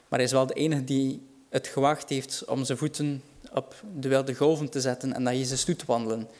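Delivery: normal talking voice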